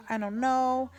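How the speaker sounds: normal talking voice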